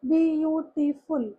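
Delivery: slow "बी... यू... टी... फुल" with gaps; teacher sounding out "बी यू टी फुल" slowly; syllable by syllable. Shown as a demonstration. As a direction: break "बी यू टी फुल" into separate beats. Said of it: "अब हम इसको pronounce करते हैं".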